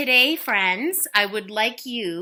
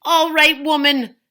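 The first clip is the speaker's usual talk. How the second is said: loud, high giant bellow; 7 semitones higher, 9 dB louder than usual. Acted. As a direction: boom it through the door. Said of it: "bellowed the giant at the door".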